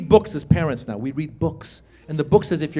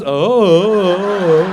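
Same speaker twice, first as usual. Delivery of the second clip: long, drawn-out vacant "oh"; spaced out; 4 dB louder than usual. Acted. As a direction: drone it like a zoned-out boy. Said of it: "boys get a little spaced out".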